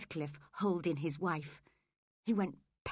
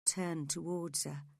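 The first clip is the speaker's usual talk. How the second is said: usual speaking voice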